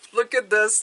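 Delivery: normal talking voice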